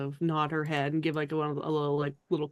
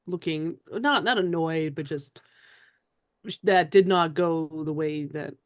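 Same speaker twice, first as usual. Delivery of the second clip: ordinary delivery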